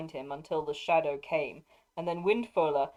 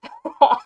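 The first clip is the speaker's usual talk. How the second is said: loud, clear mocking laugh; ringing laugh; 8 semitones higher, 13 dB louder than usual. Dernhelm laughing at the Nazgul's boast, a loud ringing laugh. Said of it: "It seemed that Dernhelm laughed".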